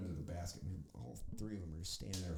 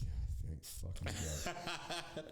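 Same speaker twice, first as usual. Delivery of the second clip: laughing relief; 2 semitones lower, much slower than usual. Retold or imitated. Dead man relieved, laughing.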